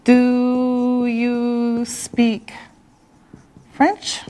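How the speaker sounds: normal talking voice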